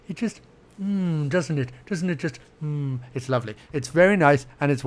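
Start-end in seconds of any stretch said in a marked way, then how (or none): 0.1-3.5 soft savouring. Pretend. Savouring the stereo sound.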